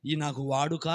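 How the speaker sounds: put-on low, soft pleading boy; bow low and beg the king; humble David. David begging King Saul softly to refuse armour. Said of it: "అందుకు అన్నాడు".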